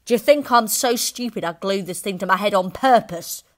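loud indignant yelling; angry shout. A narrator's reading. Husband yelling at his wife, indignant. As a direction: bellow in outrage. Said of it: "Mister Wormwood shouted".

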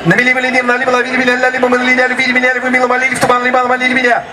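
rapid-fire tongue twister; said really fast. Shown as a demonstration. Teacher showing students the tongue twister at full speed. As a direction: rattle it off as fast as you can. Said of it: "I'm going to say this tongue twister really fast".

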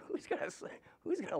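breathy laugh through the words. Real, not acted. Man joking nobody would marry him, laughing. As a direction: let a laugh swallow the question.

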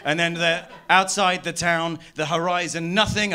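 ordinary delivery

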